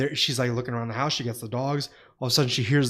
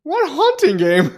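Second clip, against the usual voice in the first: high baffled question; mock confusion; 11 semitones higher than usual. Retelling his mix-up, puzzled. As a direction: sound puzzled.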